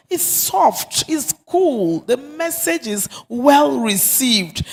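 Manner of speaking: normal talking voice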